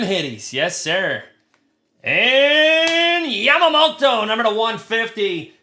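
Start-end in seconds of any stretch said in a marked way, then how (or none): 2.0-5.5 excited. Real, not acted. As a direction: stretch the name out like an announcer. Big hit pulled on stream.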